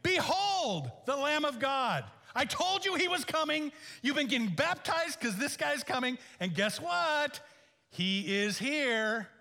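loud, high, ringing proclamation; John the Baptist proclaiming. Acted. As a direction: announce him like a prophet to the crowd. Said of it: "John the Baptist says".

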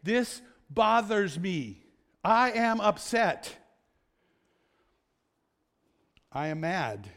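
slow, deliberate statement of feeling; declaring emotions. Shown as a demonstration. Preacher modelling how we declare our emotions, slow deliberate declaration. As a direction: own it slowly, one firm word at a time. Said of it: "where we declare our emotions and say".

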